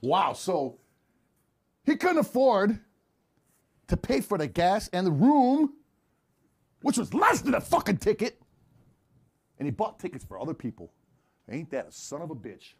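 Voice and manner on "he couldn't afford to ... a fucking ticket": loud, high, indignant questioning; mock outrage. Questioner sums up the unpaid gas and room, indignant loud delivery. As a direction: hammer out each phrase, loudly incredulous.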